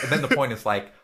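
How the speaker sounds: ordinary talk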